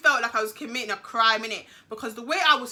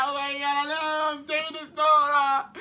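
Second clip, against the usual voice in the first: fake-cry through the words in a whine; hammed-up tearful wail; pretend crying. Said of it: "like, pretending to cry".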